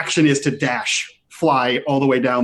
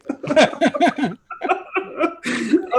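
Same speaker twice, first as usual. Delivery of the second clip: high burst of laughter; helpless high-pitched laugh; 12 semitones higher than usual. Real, not acted. Reacting to the distance.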